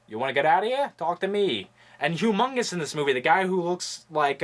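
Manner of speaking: ordinary talk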